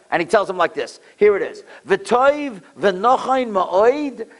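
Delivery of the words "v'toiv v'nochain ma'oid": measured, solemn recitation; slow text reading. Shown as a demonstration. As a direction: read the Hebrew very slowly. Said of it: "Listen to what the Satmar Rebbe read".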